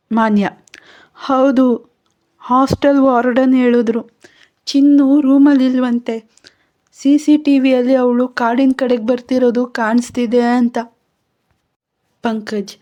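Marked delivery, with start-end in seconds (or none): 1.1-10.8 higher, louder frantic words; stumbling over her sister in fear; frightened. Acted. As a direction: Manya telling Vikram her sister is in danger, panicked. Manya reports frightening news.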